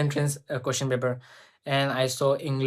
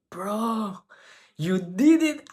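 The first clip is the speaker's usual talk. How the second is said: high, thrilled "Bro, you did it"; excited self-cheer; 6 semitones higher, much slower than usual. Retold or imitated. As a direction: cheer yourself on, overjoyed. Retelling own reaction to selection.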